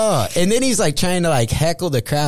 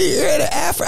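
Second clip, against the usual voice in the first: shouted disbelief. Real, not acted. Comic shouting in disbelief about cops at the Afro Man show. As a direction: yell it out in disbelief.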